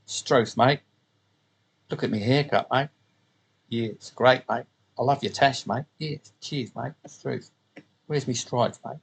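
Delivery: lightly hammed-up Australian accent, every line ending in mate; mock Aussie. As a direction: play two Aussie blokes chatting in broad Australian. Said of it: "typical Aussie".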